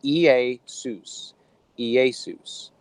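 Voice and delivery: "Iesus" drawn out as ee-ay-SOOS; Greek pronunciation. Shown as a demonstration. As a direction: say "Iesus" slowly, syllable by syllable. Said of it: "in the Greek, it would be pronounced".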